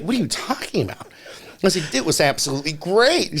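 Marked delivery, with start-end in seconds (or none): 0.0-1.1 high-pitched baffled question; retold astonished reply; incredulous. Acts out his baffled reply. 1.9-3.4 high, enthusiastic rave; retold burst of delight; gushing. Acts out gushing to the agent.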